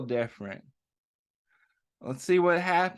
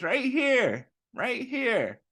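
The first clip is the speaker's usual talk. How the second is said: put-on higher, matter-of-fact kid voice; Joe answering; 7 semitones higher, slower than usual. A narrator's reading. Reading to children, Joe shows the kids his leg. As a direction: point it out simply, like a child.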